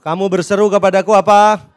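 loud, measured scripture recitation; leading unison reading. Real, not acted. Preacher leads congregation reading Lukas, loud and measured. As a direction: read slowly and loudly in separated phrases. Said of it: "Ya, sama-sama. Dua, tiga".

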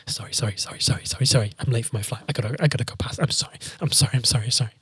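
hushed, repetitive mock-apologetic patter; flustered apologising. Late passenger squeezing past a queue, hushed flustered apologies. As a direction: imitate a meek queue jumper excusing themselves, voice kept down.

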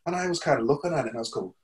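normal talking voice

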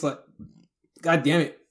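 ordinary talk

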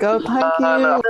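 high-pitched put-on gratitude; mock polite thanks. Dater handed garage coffee, hammed-up high thanks. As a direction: thank them sweetly, overdoing it.

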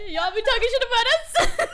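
high, loud mock accusation; laughing call-out. Calling out the Sarahs who gossip, loud laughing accusation. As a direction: call them out while cracking up.

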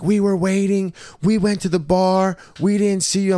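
high mocking ex girlfriend. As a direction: mimic her high, whiny excuse. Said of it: "She's like".

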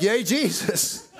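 drawn-out delighted cheer with a laugh. Retold or imitated. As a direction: laugh out a slow happy cheer. Cheering after a healing.

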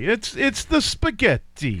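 playful fake Italian accent. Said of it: "Three is Italian".

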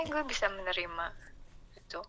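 ordinary talk, nothing marked

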